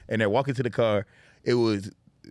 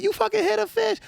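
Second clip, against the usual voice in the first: much higher, accusing squeal; mock shocked ex-wife. Ex-wife scolding him for hitting the fish, high mock outrage. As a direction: shriek it as the appalled ex-wife.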